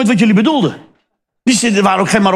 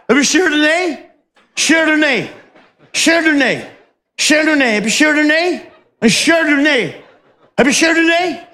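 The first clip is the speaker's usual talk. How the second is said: higher, affected "Chardonnay" over and over; posh student voice; 7 semitones higher than usual. Retold or imitated. Posh student orders wine at the snack bar, mocking. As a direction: preen like a spoilt rich kid ordering wine. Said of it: "zo'n studentenbol".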